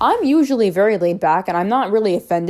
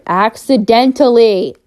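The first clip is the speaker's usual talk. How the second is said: accidentally drawn out slow and loud; stress on accidentally; 4 semitones higher, 6 dB louder, slower than usual. Real, not acted. Pointing out to viewers it was an accident, stressed. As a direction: lean hard on accidentally, slowly.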